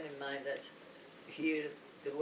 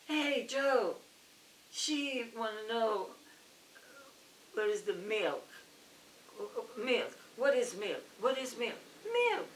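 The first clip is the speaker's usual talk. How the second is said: higher puzzled clerk calling his coworker; confused clerk calling out; 5 semitones higher than usual. Retold or imitated. Clerk calls to coworker Joe, baffled and higher. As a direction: pitch up, baffled, calling across the store.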